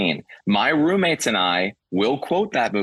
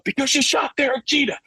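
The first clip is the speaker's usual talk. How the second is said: much higher, put-on character voice; movie quote voice. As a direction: squeal the line like the movie character. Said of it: "how much we quote the".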